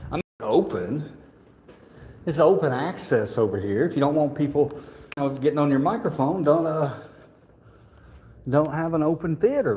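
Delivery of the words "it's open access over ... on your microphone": usual speaking voice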